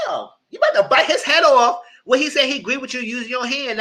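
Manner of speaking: high, loud acted rebuke; exasperated scolding. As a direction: lay into him for overreacting. Acted rebuke of his overreaction.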